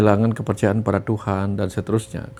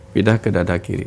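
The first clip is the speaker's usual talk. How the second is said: ordinary talk, nothing marked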